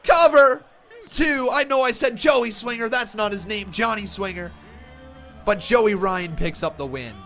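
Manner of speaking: loud, high, drawn-out ringside call; wrestling commentator. Real, not acted. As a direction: shout the finish like a commentator. Live call of the finish.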